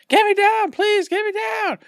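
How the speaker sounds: very high squeaky little voice begging to be let down; small trapped gnome crying out in a high squeal; gnome pleading. Retold or imitated. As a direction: pitch way up into a tiny creature's plea. Gnome strung up on windmill pleading.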